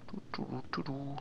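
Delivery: breathy whispered du du; hushed nervous chant; whispering. Real, not acted. Sneaking, humming under breath.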